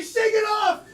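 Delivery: trash talk. Retold or imitated. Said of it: "and say".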